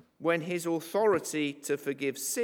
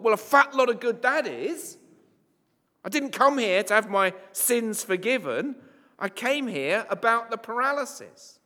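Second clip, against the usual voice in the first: indignant grumble; 6 semitones higher, 5 dB louder than usual. Pretend. Paralysed man's imagined thought.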